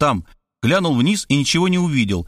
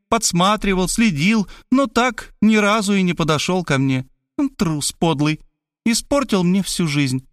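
high, scornful woman's voice; woman complaining about the cowardly boy; bitter complaint; 7 semitones higher than usual. Acted. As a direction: vent years of resentment. She recalls the shy boy bitterly.

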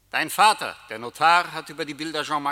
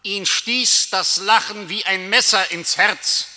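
loud, higher, ringing declamation; dramatic outburst; 6 semitones higher, 10 dB louder than usual. Acted. Death hammers home the painful laughter, loud and high. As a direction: strike the line like a blow.

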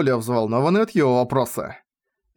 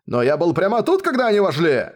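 higher-pitched, mildly insistent man; insistent protest; 5 semitones higher than usual. A narrator's reading. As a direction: insist on your claim. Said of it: "настаивал другой мужчина".

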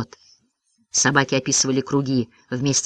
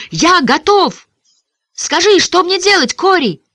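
high, louder, determined call; through gritted teeth; 13 semitones higher, 5 dB louder than usual. A narrator's reading. Davy Ray asking Cory what to do, gritted-teeth determination. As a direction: push the words out, eager and resolved. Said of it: "сжав зубы".

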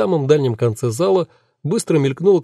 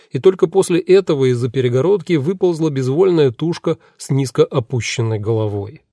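ordinary delivery, nothing marked

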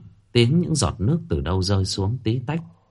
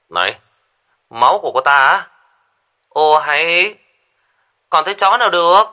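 loud, high and curt; brusque; 8 semitones higher, 7 dB louder than usual. Acted. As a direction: snap it out rudely. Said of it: "Duy nhắn tin một cách cục xúc".

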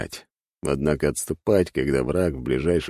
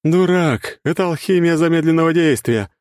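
laughing taunt; 11 semitones higher, 5 dB louder than usual. Acted. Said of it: "хохотнула Надежда".

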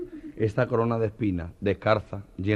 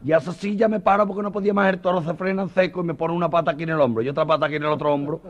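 higher, breathless telling; excitable banderillero; 8 semitones higher, 5 dB louder than usual. Acted. A banderillero telling the bullfight crowd, higher and breathless. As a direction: tell it like it just happened.

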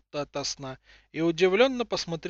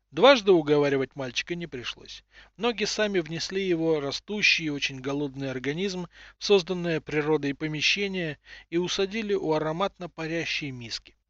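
ordinary delivery

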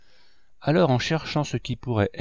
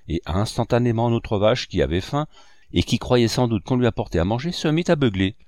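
plain reading voice

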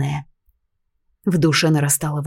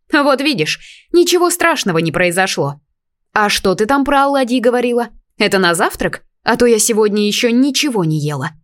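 higher, louder chirpy fairy voice; pixie voice; 5 semitones higher, 4 dB louder than usual. A narrator's reading. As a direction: chatter eagerly like a hungry little fairy. Fairy cheerfully begs breakfast.

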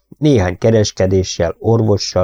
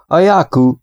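higher, louder call over the shoulder; meaningful call back; 9 semitones higher, 4 dB louder than usual. Acted. Bob calling back to Molly from the driver's seat, meaningful. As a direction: announce the river with weight. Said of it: "szólt hátra jelentősen Bobapó".

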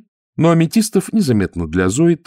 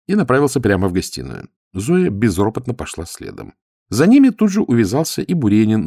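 ordinary delivery

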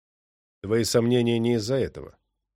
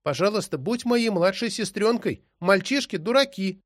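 put-on little girl voice; 7 semitones higher than usual. A narrator's reading. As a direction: beg sweetly like a small girl. Little girl pleads to belly.

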